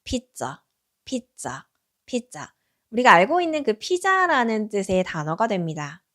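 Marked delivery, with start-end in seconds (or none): 0.0-2.5 피자 said much softer and lower; soft repeated model. Shown as a demonstration. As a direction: say 피자 gently, low and soft. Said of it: "한번 해보겠습니다". (부드럽고 낮게 반복하는 피자)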